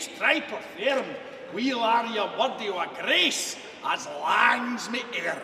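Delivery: booming dramatic Scottish recitation; poem recitation. Acted. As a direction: declaim the poem with full theatrical force. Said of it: "the address to a haggis".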